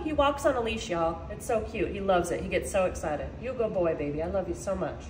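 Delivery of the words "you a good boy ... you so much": low, soft affection; soft, doting and low; tender pet talk. Real, not acted. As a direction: murmur love to your dog. Tender words to her dog.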